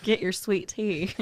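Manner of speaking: put-on slow, drawn-out Southern accent. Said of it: "I cannot do a Southern accent".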